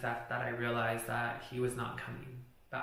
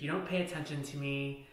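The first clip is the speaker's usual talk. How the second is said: ordinary delivery, nothing marked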